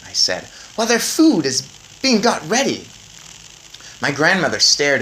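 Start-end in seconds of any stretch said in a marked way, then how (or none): 0.8-2.9 put-on high, eager boy voice; a clever boy explaining. A narrator's reading.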